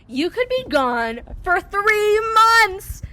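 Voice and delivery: high, slow, loud ominous announcement; mock dramatic. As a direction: boom it out like a doom verdict. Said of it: "And she goes".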